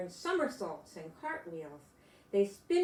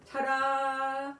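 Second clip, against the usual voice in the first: louder, higher grand reveal; showman's ta-da; 4 semitones higher, 5 dB louder than usual. Acted. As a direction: announce it like a ringmaster. Presenting the next act.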